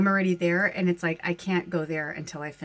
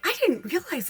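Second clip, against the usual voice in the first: husband's amazement; 11 semitones higher than usual. Retold or imitated. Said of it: "He's like".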